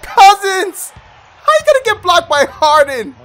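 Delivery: exasperated shout. Real, not acted. Gamer yelling at teammate Cousins, exasperated shout. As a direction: call him out in disbelief.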